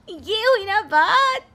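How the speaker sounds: खुशी से ज़ोर से खींचकर; thrilled exclamation. Acted. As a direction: cheer it out, stretching each word. Thrilled the dialogue was recognized.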